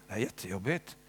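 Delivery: a softly voiced lament in prayer; quiet complaining prayer. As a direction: confide your hurts to Jesus, hushed. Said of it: "Jag säger så här".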